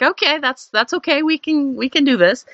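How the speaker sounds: imitating a man losing his nerve; nervous high reassurance. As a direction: squeak out a shaky okay like a man suddenly worried. Swinger guy nervously agreeing.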